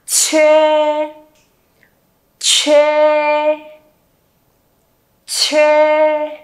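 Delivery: each "Chê" with an airy burst, then held level; aspirated initial. Shown as a demonstration. Teacher drills class on aspiration, "Chê" breathed out strongly. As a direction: puff hard on every "Chê", leaving a pause between. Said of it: "Bật hơi nào".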